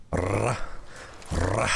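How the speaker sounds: "Ra, ra" with the R trilled; attempted rolled R. Shown as a demonstration. Streamer practicing from a painful Spanish lesson, "Ra, ra" with rolled R. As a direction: try rolling the R in each "Ra". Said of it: "I can't roll my R's".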